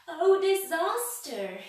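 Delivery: high, loud, slow terrified scream. A narrator's reading. Mister Jelly wakes to a giant face, screaming high. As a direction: scream it out, drawn long. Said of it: "he screamed".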